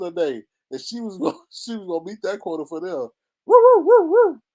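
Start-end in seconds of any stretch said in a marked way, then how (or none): 3.5-4.4 high, loud imitation woofs; dogs barking. Vlogger imitating dogs after their quota, high loud barking. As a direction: yelp like excited sniffer dogs. Dogs' barking acted again.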